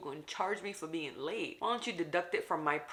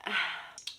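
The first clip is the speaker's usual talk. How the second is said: pitying sigh; 2 semitones higher than usual. Retold or imitated. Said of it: "looked at me and was like".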